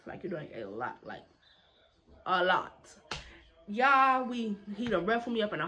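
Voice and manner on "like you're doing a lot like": much lower and softer, muttered aside; muttered under breath. Shown as a demonstration. Rolling her eyes at the teacher, much lower and softer mutter. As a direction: drop the voice and mutter it to yourself.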